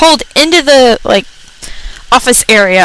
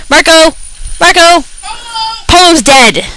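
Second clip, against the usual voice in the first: higher playful call-out; mock calling out; 6 semitones higher than usual. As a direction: call Marco like in the game.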